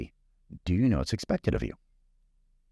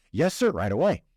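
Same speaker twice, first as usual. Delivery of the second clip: higher, eager-to-please clerk voice; very excited; 6 semitones higher than usual. Acted. Clerk takes the guest's milk order, very excited. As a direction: act out an instant, delighted yes to a customer. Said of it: "very excited, very happy to help".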